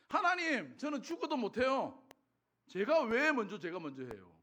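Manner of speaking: retold indignant protest, high and loud bursts; mock outraged objection; arguing with God. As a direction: protest loudly like someone treated unfairly. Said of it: "하나님 앞에 막 따졌거든요".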